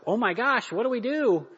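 high-pitched flustered outburst; mock panic. Mimics a panicked, unprepared reaction.

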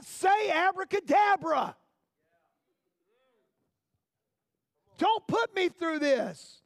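impatient pleading. Acted. Believer pleading impatiently with God.

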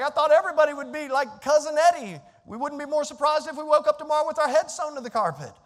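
loud, high exaggerated disbelief; lively joking astonishment, high and loud; excited. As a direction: ham up the shock. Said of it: "I was like".

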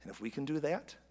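slow, low, hushed preaching; solemn sermon close. Real, not acted. Pastor urging congregation to give grace, quietly solemn. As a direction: bring it down to a soft, weighty appeal with long pauses.

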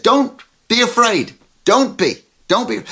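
firm call of comfort. Retold or imitated. Jesus calming terrified disciples, loud firm reassurance. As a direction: call it out firmly over the storm. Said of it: "he said".

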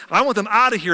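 a wife telling her husband to expel them, loud; demanding wife. Retold or imitated. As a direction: put her foot down hard. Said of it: "she's like".

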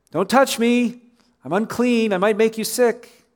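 high-pitched call to keep others away; acted outcast calling out, pitched well up; leper's warning cry. Acting the leper's shamed warning cry.

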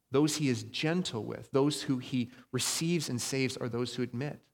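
ordinary talk, nothing marked

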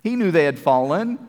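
normal talking voice

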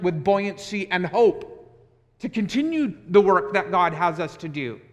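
impassioned sermon delivery; preaching at full force. Real, not acted. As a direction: preach it out with rising fervour.

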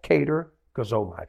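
slow, measured "Katergazomai" for repeating; pronunciation demo. Shown as a demonstration. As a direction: model "Katergazomai" in two careful parts. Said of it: "Can you try that?".

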